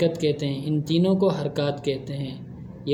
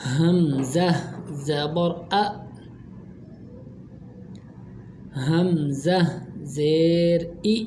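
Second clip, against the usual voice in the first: drawn-out syllables, each one held slowly; slow recitation for repeating; slower than usual. Shown as a demonstration. Teacher recites the letters for pupils to repeat, slow and drawn. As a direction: read slowly so children can repeat after. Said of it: "अब मैं पढ़ता हूँ और मेरे साथ साथ पढ़ते जाएं".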